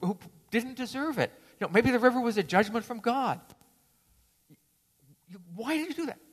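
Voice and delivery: high, indignant reproach; angry scolding. Acted. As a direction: scold him for breaking his vow. Monk scolds the other monk.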